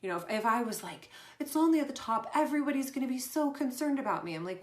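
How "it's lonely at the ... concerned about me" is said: high, louder mocking voice of a self-pitying star; mock lament, pitched up; mock whiny self-pity. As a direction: ham up a poor-me diva.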